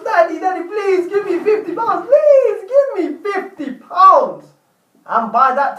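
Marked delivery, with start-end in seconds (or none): 0.0-2.1 put-on high, whiny little boy begging his dad; pleading child. Son pleading with dad for money. 2.1-4.5 high, louder, slow whining plea; drawn-out child plea. Acted. Son pleading with dad for money.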